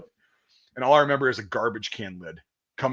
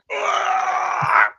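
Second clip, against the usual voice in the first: imitated retch. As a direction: heave it out into the tree. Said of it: "It was like".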